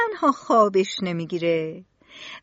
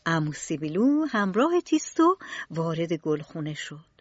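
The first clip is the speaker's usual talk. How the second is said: ordinary delivery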